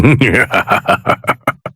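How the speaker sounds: low amused chuckle; villain's chuckle. Acted. God amused by a student's failure, low chuckle. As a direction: chuckle to yourself, unimpressed.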